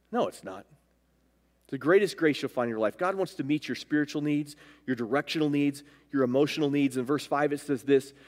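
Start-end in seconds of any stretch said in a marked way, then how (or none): none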